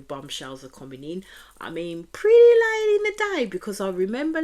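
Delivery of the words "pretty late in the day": a sudden high, loud cry of disbelief; exasperated outburst. Real, not acted. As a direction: blurt it out, shocked how late it is. Outburst about late bombshells.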